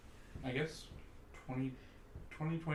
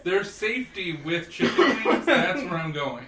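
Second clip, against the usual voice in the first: laughing; 6 semitones higher, 12 dB louder than usual. Real, not acted. Joking along, laughing.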